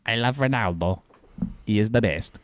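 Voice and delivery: nasally put-on Portuguese accent. Shown as a demonstration. Correcting the imitation of the co-host, nasal Portuguese accent. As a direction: mimic a Portuguese speaker through the nose. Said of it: "you sound more nasally than that".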